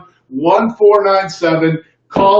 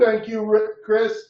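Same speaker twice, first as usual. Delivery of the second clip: usual speaking voice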